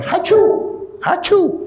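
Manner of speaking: high-pitched fake "hachu" sneeze; exaggerated, clearly faked sneezing; mock sneeze. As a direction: squeal out a put-on sneeze. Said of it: "The Yahud started going".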